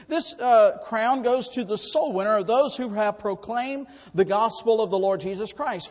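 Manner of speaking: normal talking voice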